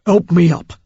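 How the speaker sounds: a wounded Cockney bark; rough Cockney. A narrator's reading. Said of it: "he said roughly".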